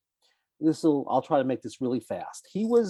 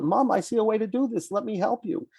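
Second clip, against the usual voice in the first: lightly voiced child, pitched much higher; eager child; 9 semitones higher than usual. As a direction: imitate a child earnestly offering mom help. Child offering help to mother.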